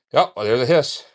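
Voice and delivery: ordinary talk, nothing marked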